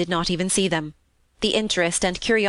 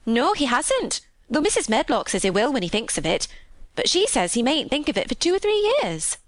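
higher, lilting Yorkshire maid; chatty country housemaid; 4 semitones higher than usual. Acted. Maid relaying her mother's questions, chatty. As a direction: pass on mother's concern as a gossipy maid. Martha retells talk with mother.

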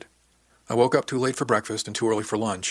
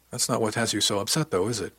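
ordinary delivery

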